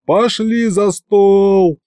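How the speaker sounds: slow, high celebratory call; drawn-out rallying cry; crowd's yell. A narrator's reading. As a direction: stretch it out like a cheering crowd. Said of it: "завопила площадь".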